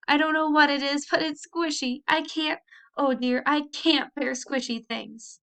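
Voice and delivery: high, whimpering complaint; tearful. A narrator's reading. Annabel complains to her aunt, tearful and high. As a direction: sob out the words, helpless. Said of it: "Annabel was still sobbing".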